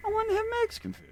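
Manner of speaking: put-on childish inner whine; whiny inner voice. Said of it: "I can think in my head".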